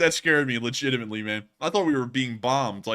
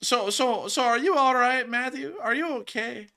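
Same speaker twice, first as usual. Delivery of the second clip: mock concern; 10 semitones higher than usual. Checking if the co-host is all right, mock-concerned. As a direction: fuss over them in a high tone.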